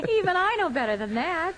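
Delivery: amused wife ribbing her husband; laughing tease. Acted. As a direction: still laughing as she teases him. Wife teasing him, laughing.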